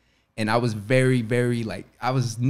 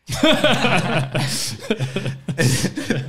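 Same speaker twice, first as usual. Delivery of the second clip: loud, high laugh; real laughter, loud and high; burst of laughter; 10 semitones higher, 7 dB louder than usual. Real, not acted. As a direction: crack up at the memory. Laughs at the Taft Hartley mix-up.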